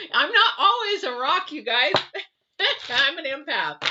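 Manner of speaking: high and loud, words spilling through a real laugh. Real, not acted. Admitting to viewers she isn't always strong, laughing. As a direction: laugh while confessing to viewers.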